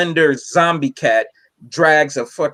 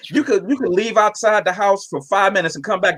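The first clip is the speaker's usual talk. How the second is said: ordinary talk, nothing marked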